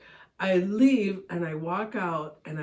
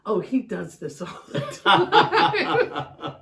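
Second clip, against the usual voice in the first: higher, louder, slow mock quote breaking into laughs; laughing retold quote; 5 semitones higher, 6 dB louder, slower than usual. Wife retelling the post office lady's answer, laughing. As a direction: retell her line while cracking up. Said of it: "the lady goes".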